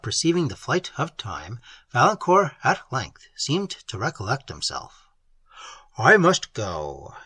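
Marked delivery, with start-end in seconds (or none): 6.0-7.2 slow, low and mournful; a lover forced to leave, drawn out slowly. A narrator's reading. Valancourt to Emily, slow and mournful. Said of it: "said he mournfully".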